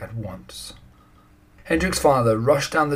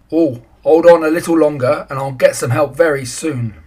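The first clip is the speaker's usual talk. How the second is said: louder, higher reassuring call; 4 semitones higher, 7 dB louder than usual. A narrator's reading. Man reassuring the boy.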